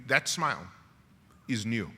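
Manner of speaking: ordinary delivery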